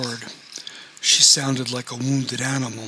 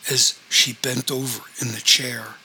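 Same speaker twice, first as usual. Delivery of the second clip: usual speaking voice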